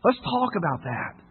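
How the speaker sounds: soft, slow, accepting reply; gentle reassurance. Retold or imitated. Father answers his doubting son, gently reassuring. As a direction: welcome it quietly, taking your time.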